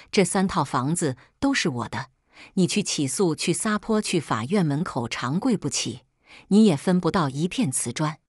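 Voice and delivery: ordinary delivery, nothing marked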